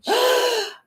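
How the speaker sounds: high sharp gasp of shock. Retold or imitated. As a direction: suck in air like you just heard the unthinkable. Truth will set you free.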